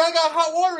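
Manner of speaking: high, loud announcement; excited. Retold or imitated. Tenant greeting the plumber, high and loud. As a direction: shout it gleefully. Calling to Hector.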